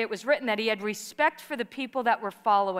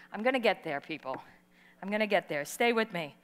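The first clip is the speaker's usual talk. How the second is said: softer, lower, confiding aside; quiet aside; 5 semitones lower, 6 dB quieter, faster than usual. Real, not acted. Preacher reassuring the congregation she'll get there, quietly. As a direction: lean in and reassure them softly.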